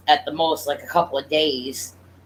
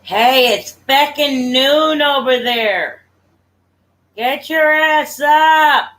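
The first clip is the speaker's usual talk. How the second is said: calling out loudly, higher; shouting; 8 semitones higher, 4 dB louder than usual. Real, not acted. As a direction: yell across to wake them. Calling someone to get up.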